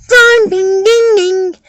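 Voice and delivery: high, loud ringing dings; imitated bell. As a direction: ring the words out like a bell.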